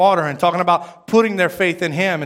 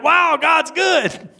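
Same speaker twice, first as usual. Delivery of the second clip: high, drawn-out cry of mock amazement; amazed praise; 9 semitones higher, much slower than usual. Churchgoers marveling at an answered prayer, mock amazed. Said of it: "like we were surprised it happened".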